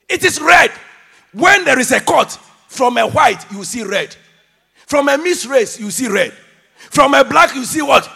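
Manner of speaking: loud call in short hammered phrases; forceful preaching. Real, not acted. Preacher proving all blood is red, loud and emphatic. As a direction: pound out each parallel phrase.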